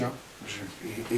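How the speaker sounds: ordinary delivery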